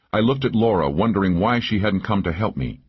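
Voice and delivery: ordinary delivery, nothing marked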